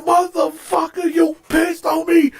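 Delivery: high, indignant re-enacted cursing; outraged accusation. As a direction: replay the fury mid-fight. Retold fight.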